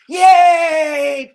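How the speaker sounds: put-on loud, high childish cheer; excited kids cheering. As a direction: shout it like thrilled kids at a party. Kids cheering for party game.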